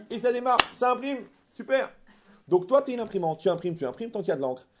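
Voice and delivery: plus fort et plus haut, emporté; s'emballer sur l'image; emballé. Real, not acted. Sermon emporté sur l'imprimante. (prédicateur emballé, plus fort et plus aigu)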